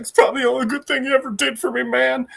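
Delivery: high, rushed, choked-up quote; acted teary complaint; teary-eyed. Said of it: "he starts getting all teary-eyed".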